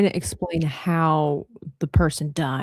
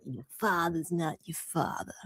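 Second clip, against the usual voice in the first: quiet Caribbean-accented medium impression; hushed mimicking of a TV psychic; Madame Cleo; 3 semitones lower, 11 dB quieter than usual. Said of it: "the Caribbean medium".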